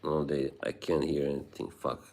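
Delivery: ordinary delivery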